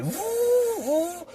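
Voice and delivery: airy high woo woo; high relieved whoops. Retold or imitated. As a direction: let out a light whoop of escape. Relief after getting away.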